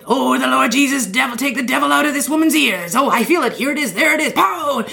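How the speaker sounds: high, hammed-up faith-healer preaching; televangelist healing. Preacher casting the devil out of a woman's ears, hammed-up and high.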